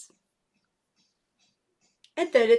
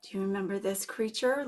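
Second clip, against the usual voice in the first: usual speaking voice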